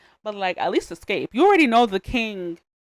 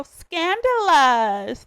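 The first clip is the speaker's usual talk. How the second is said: high, slow, drawn-out gasp of "scandalous"; stretched, high mock-shocked "Oops, scandalous"; Ashley-style scandalous; 7 semitones higher, 5 dB louder, much slower than usual. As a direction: play the gossipy cartoon girl catching a scandal. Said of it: "three girls named Ashley, and they go".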